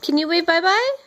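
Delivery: ordinary talk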